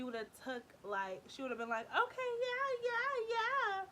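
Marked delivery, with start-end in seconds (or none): 1.9-3.8 high, loud, stretched-out imitation of a keen flirt; hammed-up gushing yes; mock eagerness. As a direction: squeal agreement, dragging each word. Imagining friend eagerly flirting back.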